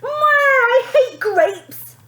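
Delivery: high, loud, slow wail; a tantrum wail of a spoilt girl. A narrator's reading. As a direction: wail it like a bawling child.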